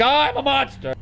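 high-pitched hammed-up self-reproach; mock anguished wail. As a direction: wail it like an overdramatic idiot. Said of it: "he's like".

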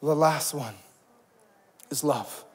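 hushed, leading the church to repeat; soft call and response. Shown as a demonstration. Pastor leads the congregation in quiet unison. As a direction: say it softly with them. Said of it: "Come and say it with me".

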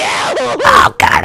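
high panicked yell; alarm shout. Real, not acted. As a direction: cry out in sudden fright. Tornado hits, panic.

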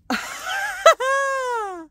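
long high-pitched shriek of laughter; real helpless shriek of laughter. Real, not acted. Drills spill everywhere like snow.

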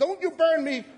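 imitating a hysterical woman screaming; frantic shriek. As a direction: shriek it like she's terrified of being burned. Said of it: "freaking out".

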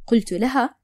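usual speaking voice

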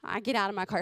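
normal talking voice

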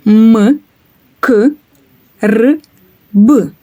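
М, К, Р, Б each named alone with pauses; sounds one by one. Shown as a demonstration. Teacher naming each consonant for the class. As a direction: isolate each consonant of «мікроб». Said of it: "Давайте їх назвемо".

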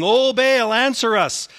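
high, loud desperate plea to an idol; loud pleading cry; crying out to Baal. Acted. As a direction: call out like frantic prophets begging their god.

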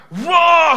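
loud, high roar; imitated fire roar. Preacher acting out the fire for church, loud roar. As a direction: roar like a blaze going up.

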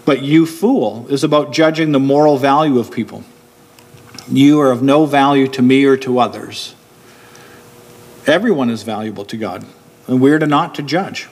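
ordinary talk

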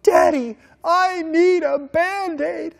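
put-on high, loud, slow child's plea; retold toddler scream, pleading; imitating a screaming child. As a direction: beg for a Band-Aid like a frightened three-year-old.

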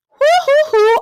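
loud, very high hoots; whooping. Real, not acted. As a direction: whoop it out in disbelief. Whoops after her outburst.

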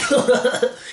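high, loud burst of laughter. Real, not acted. Viewer amused by own mockery, loud high laugh. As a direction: crack up at your own joke.

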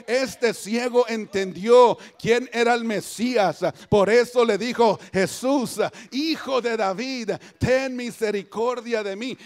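loud, emphatic preaching. Real, not acted. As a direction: drive the point home to the congregation. (predicación fuerte y enfática)

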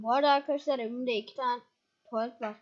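ordinary delivery

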